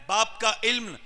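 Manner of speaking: poem recited, swelling to a loud climax; reciting Iqbal. Retold or imitated. Preacher reciting Iqbal's verse to the crowd, building loud. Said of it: "और इकबाल कहता है".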